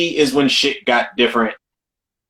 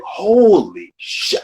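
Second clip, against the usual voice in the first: slow, drawn-out astonishment, pitched up; retold amazement; 4 semitones higher, much slower than usual. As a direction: stretch it out in awed disbelief. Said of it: "I was like".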